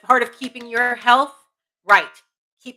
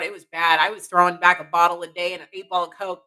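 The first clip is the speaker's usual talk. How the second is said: ordinary talk, nothing marked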